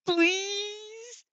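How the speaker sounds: long, high drawn-out wail; wailing plea. A narrator's reading. Kaminari begging to join the study group, high wail. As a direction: beg like your life depends on it. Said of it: "Kaminari wails".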